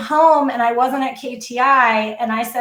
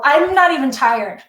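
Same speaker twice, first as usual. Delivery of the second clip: ordinary delivery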